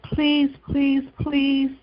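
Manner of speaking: high, pleading please, pressed out; begging snake. A narrator's reading. Snake begging the woman to pick him up. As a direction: beg for it, pitch lifted high. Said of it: "begged the snake".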